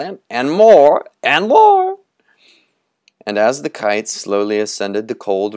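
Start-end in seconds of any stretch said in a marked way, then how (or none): 0.0-1.0 put-on loud high eager boy's call; high excited boy's shout; shouting for more. 1.2-2.0 put-on very high, loud boy's cry; high squealing boy's call; shouting for more.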